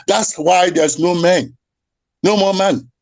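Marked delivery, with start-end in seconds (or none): none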